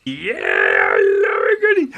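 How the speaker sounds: loud, wildly swooping crazy voice; old crazy person. Acted. Old crazy person at the swamp greeting visitors, wild loony voice. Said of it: "there was an old crazy person".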